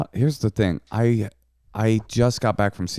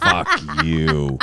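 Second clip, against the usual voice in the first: a mock curse through a laugh; half-laughing fake insult; laughing retort; 4 semitones lower than usual. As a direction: toss it off while laughing.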